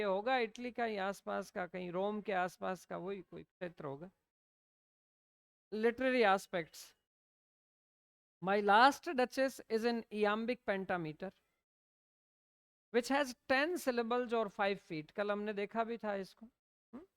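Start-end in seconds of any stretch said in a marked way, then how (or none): 5.7-15.1 slow, even note-dictation pace. Acted. Teacher dictating notes to students, slow and deliberate. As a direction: read it out word by word so students can write.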